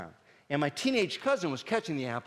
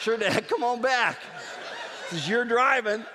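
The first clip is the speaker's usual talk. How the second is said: put-on sassy kid; 7 semitones higher, 4 dB louder than usual. Kid sassing dad in car.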